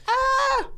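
mock high scream. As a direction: shriek in fake terror. Ghost grabbing him.

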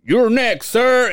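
high barker call; carny call. Retold or imitated. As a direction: announce it like a showman. Cashier calling like carny.

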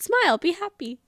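high, loud sing-song cheer; playful retold pep talk; cheering someone up. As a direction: coax brightly in a very high voice. Reads out her cheer-up message.

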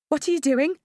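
high, loud alarmed question; mother in labour alarmed by the monitors, high; panicked. A narrator's reading. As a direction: ask in rising alarm.

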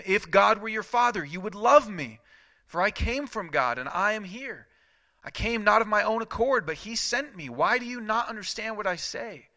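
high, loud rebuke, slowing at the end; fiery preaching. Acted. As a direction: thunder the rebuke and land on devil. Jesus confronting opponents.

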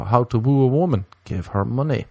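usual speaking voice

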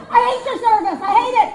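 high, very loud tirade; hammed-up enraged outburst; furious shout. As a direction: yell it at the customer survey. Acted angry answer to survey.

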